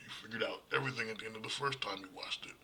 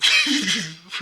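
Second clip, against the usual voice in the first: loud burst of laughter; 11 semitones higher, 16 dB louder than usual. Real, not acted. Laughing at his own impression.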